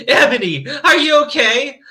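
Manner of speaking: concerned question. A narrator's reading. Bloody Mary checking on crying Ebony, concerned. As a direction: read it as an anxious check on a friend. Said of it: "asked in a concerted voice".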